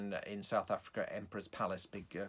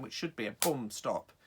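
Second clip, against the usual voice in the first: ordinary talk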